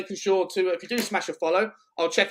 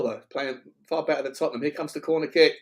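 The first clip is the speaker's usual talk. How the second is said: ordinary talk, nothing marked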